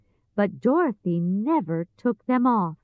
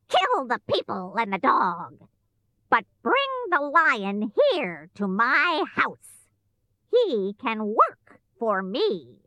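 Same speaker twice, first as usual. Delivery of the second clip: wicked witch; 2 semitones higher than usual. A narrator's reading. Witch orders monkeys to kill, imperious.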